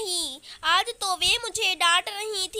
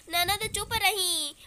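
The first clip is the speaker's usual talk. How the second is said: usual speaking voice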